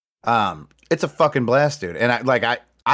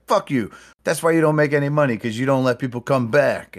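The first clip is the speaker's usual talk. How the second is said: mock yelling; 5 semitones higher than usual. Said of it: "And he's like".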